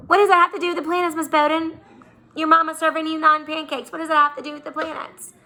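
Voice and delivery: put-on high, fast, incredulous kid voice; mock puzzled kid. Teacher voicing a doubtful student, high and fast. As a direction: squeak it out like a confused student.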